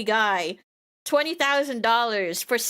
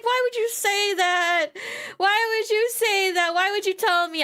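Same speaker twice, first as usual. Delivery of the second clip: mock indignation; 8 semitones higher than usual. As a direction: drag out the hurt why, high. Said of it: "And I'm like".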